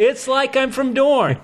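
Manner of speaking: high, laughing exclamation; gleeful boast. Real, not acted. Host after his own Dornish reading, gleeful boast. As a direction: crow it high, on the edge of laughter.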